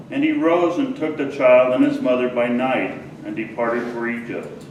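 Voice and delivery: normal talking voice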